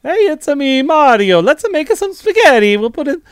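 high, loud mock Italian accent; mock Italian plumber. Actor improvising an audition, exaggerated Italian accent. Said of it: "he just started doing".

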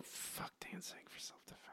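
whispered repeat of the answer; hushed echo of the right answer. Real, not acted. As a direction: mouth it back softly in disbelief.

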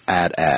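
"at at" as two words rhyming with hat hat; rhymes with hat. Shown as a demonstration. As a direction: say "at at" with both vowels as in hat. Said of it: "rhyming it with hat hat".

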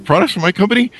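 high, shocked retold question; shocked accusation. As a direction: jump way up in outraged disbelief. CEO confronts the stealing employee.

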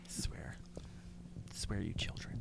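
very soft, low whisper; hushed mock scolding; muttered exasperation. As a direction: whisper it under your breath. Mock exasperation after being teased.

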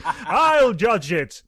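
slow, high, laughing mock voice; mocking imitation. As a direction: drag it out to tease him. Mocking co-host's opinion.

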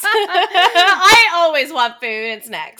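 real laugh, then slow, loud words. Real, not acted. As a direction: giggle it out, admitting it happily. Laughing about always wanting snacks.

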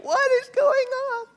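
high, slow incredulous cry; mock bewilderment. Preacher playing the baffled reader, high incredulous cry. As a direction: throw up your hands in disbelief.

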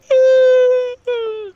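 loud, high yawn into the words; slow, drawn-out yawning speech. Real, not acted. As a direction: yawn noisily while talking. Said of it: "I was already yawning".

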